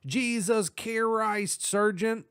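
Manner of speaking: high, slow startled exclaim; uitroep. Acted. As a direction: blurt it out, stunned and high. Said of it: "he exclaimed".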